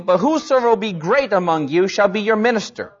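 ordinary delivery

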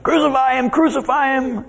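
high, urgent chant; devil stirring crowd. Acted. Devil stirring the crowd up at the cross, high goading. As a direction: egg them on in a high voice. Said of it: "stirring the crowd up".

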